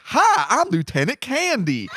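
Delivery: high-pitched overdubbed comic voice; Lieutenant Candy. Retold or imitated. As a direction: put on a silly high voice for a soldier's introduction. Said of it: "they overdubbed his voice".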